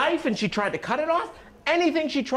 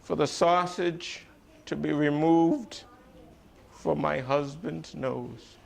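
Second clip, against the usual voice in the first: deliberate wish; slower than usual. Acted. Careful final wish.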